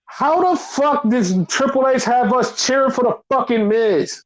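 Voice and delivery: high-pitched, baffled disbelief; can't-believe-it exasperation; incredulous outburst. Real, not acted. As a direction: rant in real bewilderment, pitch way up. Baffled rant about crowd cheering.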